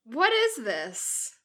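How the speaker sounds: slow, cold woman's voice; lightly voiced cold Narcissa, slowly. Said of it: "said a woman's cold voice".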